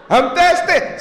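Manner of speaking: high plaintive plea; thirsty man. Pretend. Preacher voicing a thirsty man, high and pleading. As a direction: beg for water.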